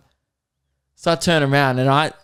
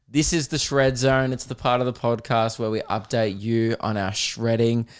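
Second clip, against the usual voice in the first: normal talking voice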